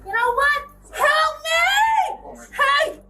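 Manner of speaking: high, frantic screaming for help; berserk rage. Real, not acted. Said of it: "You need to really stop screaming".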